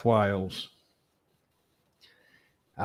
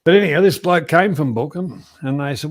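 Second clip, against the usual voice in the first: ordinary talk, nothing marked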